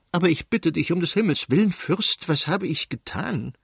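high, pleading, close to crying; near tears. Acted. The princess defends herself to her furious husband, almost weeping. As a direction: plead with him, voice about to break. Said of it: "Die Fürstin weinte beinahe".

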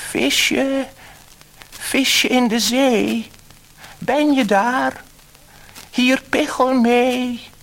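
high, loud slow call across the sea; sing-song loud calling, slow. A narrator's reading. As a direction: call out to the sea, hands cupped round the mouth. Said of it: "zei hij".

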